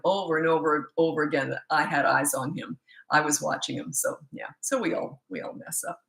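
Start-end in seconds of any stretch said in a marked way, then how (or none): none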